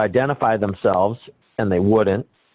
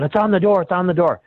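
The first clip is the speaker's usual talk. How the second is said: repeating "It's on the door" higher and more pushy each time; insistent repeating; 8 semitones higher, faster than usual. Retold or imitated. Employee insisting to customer, higher, pushy repeating. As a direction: keep pressing the same line, pitched up. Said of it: "And he keeps going".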